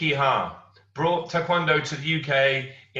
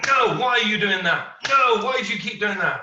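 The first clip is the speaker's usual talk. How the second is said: higher, louder imitation of an irate grandmaster; retold scolding; 7 semitones higher, 6 dB louder than usual. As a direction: bark the correction like a strict master. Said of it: "So he kept going".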